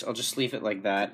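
usual speaking voice

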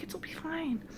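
very soft, high coaxing; hushed reassurance. Retold or imitated. Dreamer reassuring the lady gnome, hushed and coaxing. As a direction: coax gently, almost whispering.

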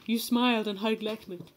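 usual speaking voice

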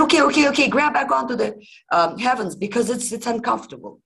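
high, loud pleading; panicked giant. Acted. Giant poked with a dagger, begging in a high panic. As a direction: squeal it out in a hurry.

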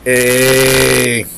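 loud, held, level shout; hammed-up long call; Sonny. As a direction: stretch it out in a put-on voice. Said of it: "Sonny's like".

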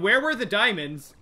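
ordinary talk, nothing marked

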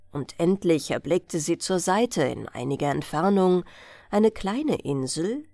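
plain reading voice

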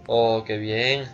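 ordinary talk

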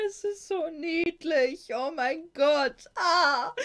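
high, loud, slow squeal near tears; overwhelmed fangirling. Real, not acted. Reader overwhelmed by the cute scene, squealing near tears. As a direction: gush as if it's too cute to bear.

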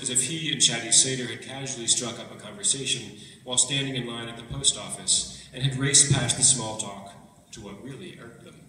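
ordinary talk, nothing marked

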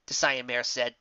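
plain reading voice

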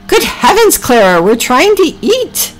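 very high, slow indignation; scandalized Ginger. A narrator's reading. Ginger reproaches Clara at dinner, scandalized. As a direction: exclaim high, drawing it out.

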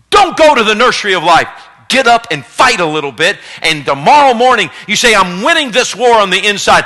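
loud, driving sermon build; rally the congregation to fight; fiery preaching. Acted. Pastor urging the church to fight, preaching hard.

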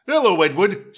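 cheery driver. Acted. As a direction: greet the little engine like a jolly driver. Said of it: "said the driver".

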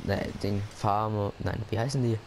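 ordinary talk

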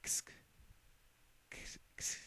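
breathy little snicker through the teeth; stifled hissing giggle; a tiny unvoiced snicker; small laugh. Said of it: "She let out a small laugh".

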